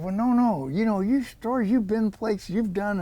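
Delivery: high, quick-to-object coaxing; insistent persuading. Retold or imitated. Company man urging him to speak, insistent persuading. Acted client brushing off his protest.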